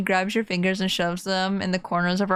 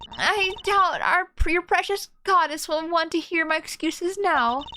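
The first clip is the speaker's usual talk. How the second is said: higher-pitched character voice; defensive game character; 9 semitones higher than usual. Acted. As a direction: answer the accuser in character. Voices game character answering accuser.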